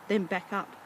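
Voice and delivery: usual speaking voice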